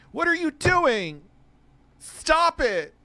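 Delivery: high, slow mock whimper; mock crybaby whine. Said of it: "I'm gonna have to cry in a corner".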